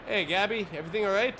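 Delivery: ordinary talk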